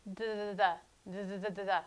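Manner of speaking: the, the, the with a soft Spanish d; soft d drill. Shown as a demonstration. Teacher drills the soft Spanish d. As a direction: tap "the" lightly with the tongue, over and over. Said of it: "the soft they of Spanish".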